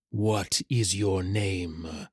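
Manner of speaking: low, very slow, soft menacing creature; ominous shadow rider. Acted. Dark rider looms over the terrified hatchling, ominous and slow. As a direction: stretch each word out, deep and hushed.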